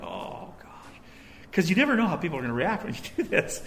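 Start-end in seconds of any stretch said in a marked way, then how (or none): 0.0-1.0 low, hushed groan of reluctance; dismayed aside. Retold or imitated. Speaker sighs to God at the prompting, quiet dread. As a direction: sink into a soft, reluctant aside.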